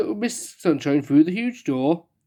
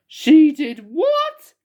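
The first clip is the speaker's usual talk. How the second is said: high, loud, slow shocked cry; the king can't believe his ears; 11 semitones higher, 6 dB louder, much slower than usual. Acted. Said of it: "cried the king".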